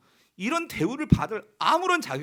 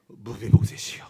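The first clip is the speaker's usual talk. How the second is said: "무비보셋이요" said much lower and full of pity, the first sound softened toward b; tender call; 11 semitones lower, 4 dB louder than usual. Shown as a demonstration. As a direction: call "무비보셋이요" low and aching, as if to a lost son. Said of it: "그래서 이걸 어떻게 말해요?".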